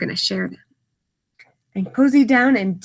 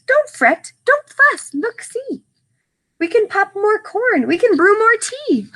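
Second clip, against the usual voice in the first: tiny comforting mouse voice, much higher; squeaky mouse; 10 semitones higher than usual. Acted. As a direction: squeak it sweetly to cheer up the bear. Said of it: "Mouse squeaks".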